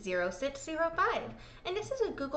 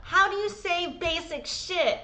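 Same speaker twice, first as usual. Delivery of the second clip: mock know-it-all; 3 semitones higher, 5 dB louder than usual. Host mocking herself to listeners for being a joke, mock-cocky then exasperated. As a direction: play yourself as cocky, then loudly clueless. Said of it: "I'll be over here like".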